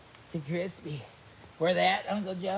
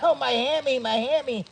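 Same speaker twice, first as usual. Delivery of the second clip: mock pain cry; 7 semitones higher, 5 dB louder than usual. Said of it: "all of a sudden".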